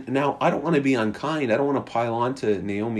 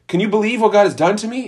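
fast, high, louder outrage; indignant complaint; 7 semitones higher, 5 dB louder, faster than usual. Retold or imitated. Naomi voiced as an outraged complainer, fast and louder. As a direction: rattle it off in disbelief. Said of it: "And so it's not".

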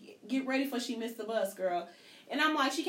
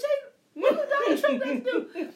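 much higher and louder through laughter; laughing question; 7 dB louder than usual. Retold or imitated. Mother questioning her kid's outburst, laughing. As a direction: ask it while still cracking up.

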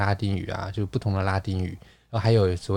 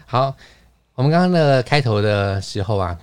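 ordinary delivery

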